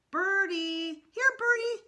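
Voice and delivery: put-on very high, loud little-kid call; calling to birds. A narrator's reading. Little brother calling to pigeons, high and loud. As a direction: coax the pigeons like a toddler. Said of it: "he called".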